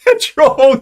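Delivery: loud, high British PETROL; hammed-up British echo; mock British accent. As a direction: shout PETROL in exaggerated British. Mimics her word, joking.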